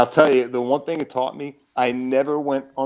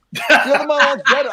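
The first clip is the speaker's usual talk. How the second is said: higher, laughing disbelief at his own words; incredulous laugh; 6 dB louder than usual. Real, not acted. Retelling his failed audition to the host, incredulous laughing echo. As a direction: echo it back, amazed and laughing through it. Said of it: "I didn't even know the other monologue".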